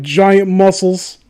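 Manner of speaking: ordinary delivery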